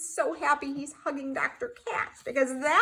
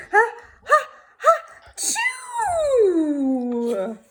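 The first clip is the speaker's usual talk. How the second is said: ha ha building up, then a loud chew; building sneeze; 7 semitones higher, 6 dB louder, much slower than usual. Acted. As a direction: wind up slowly and burst into the sneeze.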